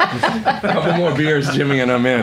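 laughing while declining. Real, not acted. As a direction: chuckle through the words. Laughing off accent request.

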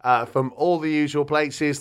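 ordinary delivery

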